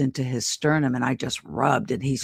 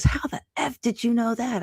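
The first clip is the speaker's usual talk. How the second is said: mock astonished patient; 8 semitones higher than usual. Patient's astonished reply retold.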